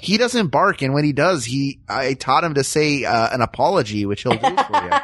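meek, over-obliging Midwesterner; mock Midwest accent, eager to please and conflict-shy. Pushover Midwesterner welcoming a robber, meek friendly Midwest accent. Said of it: "that accent is like George McFly before he punches Biff".

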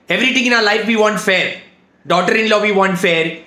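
normal talking voice

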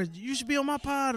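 high-pitched mock eager request; imitating eager podcasters. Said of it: "you know, everybody".

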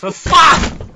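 sudden high, loud curse; angry outburst. Real, not acted. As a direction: explode in frustration as you die. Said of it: "why did you suddenly get angrier when you died".

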